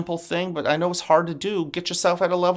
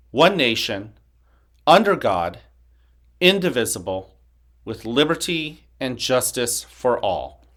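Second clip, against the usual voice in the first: loud, measured group recitation; pledge recital; 5 dB louder than usual. Acted. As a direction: lead the whole school through the pledge. Leads the Pledge of Allegiance.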